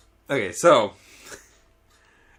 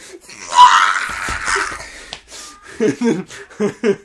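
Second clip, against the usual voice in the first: imitated tantrum scream. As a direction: scream wordlessly like a raging boy. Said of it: "and he's like".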